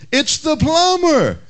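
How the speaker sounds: high, exasperated call; bewildered plumber. Retold or imitated. Bewildered plumber answering the unseen voice, high exasperated call. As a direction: answer again, baffled and high. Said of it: "bewildered plumber responded".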